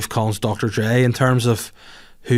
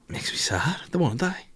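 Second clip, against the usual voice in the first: softer, half-whispered on the verge of crying; near tears; 5 semitones higher, 7 dB quieter than usual. Real, not acted. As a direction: fight back tears as you say it. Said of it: "I'm gonna fucking cry".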